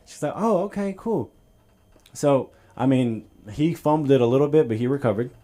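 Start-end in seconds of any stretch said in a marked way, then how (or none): 0.3-1.3 put-on girl voice. Girl accepting story.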